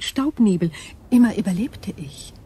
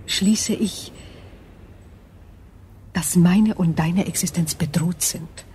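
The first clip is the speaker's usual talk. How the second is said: ordinary delivery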